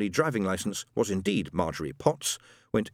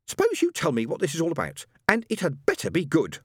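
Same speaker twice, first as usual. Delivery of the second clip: cold rage; 7 semitones higher than usual. Acted. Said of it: "cold with rage".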